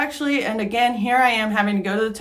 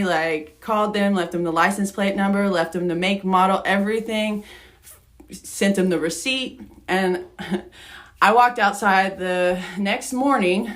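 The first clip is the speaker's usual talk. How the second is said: normal talking voice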